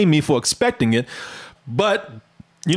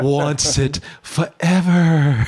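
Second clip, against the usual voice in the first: low, slow imitation of a sexy creature; Gollum; 4 semitones lower, much slower than usual. Host mimicking the guest, sexy Gollum imitation. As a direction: draw it out low, teasingly creaturely. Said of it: "she sound like sexy Gollum".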